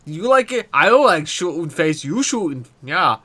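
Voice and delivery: put-on playful Russian accent in broken English; hammed-up Russian soldier, proud and blunt; mock Russian accent, playing it up for the stream. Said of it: "he loves your Russian accent".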